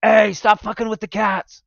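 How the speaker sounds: playful crotchety grandpa yelling; mock grumpy grandpa yell. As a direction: chase kids off the garden, hammed-up. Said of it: "I'm gonna be the grandpa that's like".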